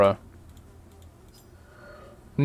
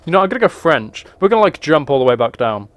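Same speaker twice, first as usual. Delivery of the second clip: usual speaking voice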